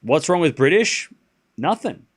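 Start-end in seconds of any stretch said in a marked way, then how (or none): none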